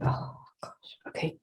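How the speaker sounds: breathless unvoiced whisper of dismay; hushed oh gosh. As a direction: whisper it to yourself, taken aback. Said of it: "I'm like".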